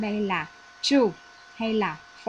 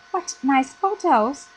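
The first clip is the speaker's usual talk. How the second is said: higher, louder, slower cry of delight; excited exclamation; 5 semitones higher, 6 dB louder than usual. Retold or imitated. Teacher reading Mai's line to the class, delighted. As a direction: gush over the photos. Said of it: "bạn Mai nói rằng".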